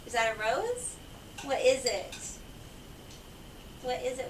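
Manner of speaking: ordinary delivery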